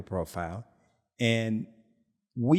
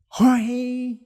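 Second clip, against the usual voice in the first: name shouted high and loud in mock rage; imitating an enraged caller; mock furious yell; 12 semitones higher, 9 dB louder than usual. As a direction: bellow his name like an angry friend on the phone. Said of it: "he was fuming mad".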